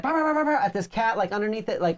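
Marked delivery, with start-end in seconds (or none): 0.0-0.6 high imitated barks; mock yappy dog barks; dog barking. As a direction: yap like a small dog. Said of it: "he was like".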